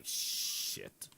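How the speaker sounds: hushed unvoiced curse; whispered curse. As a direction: breathe it as a whisper. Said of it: "He's like".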